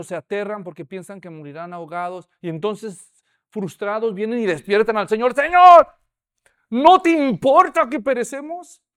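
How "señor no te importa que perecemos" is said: much higher, loud, frantic plea; panicked cry. Acted. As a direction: yell to wake him as the boat sinks. Terrified disciples wake Jesus in storm.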